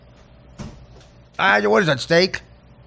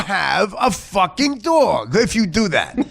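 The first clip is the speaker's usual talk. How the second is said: drawn-out, higher exasperated yell; exasperated shout; 4 semitones higher, slower than usual. Real, not acted. Host asking the owner why he has a dog, slow exasperated shout. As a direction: shout the question slowly in disbelief.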